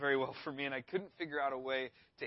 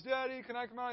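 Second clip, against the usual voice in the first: higher voice, lightly voiced little kid; imitated child; 8 semitones higher than usual. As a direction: mimic a child asking Dad hopefully. Imitates his young son asking.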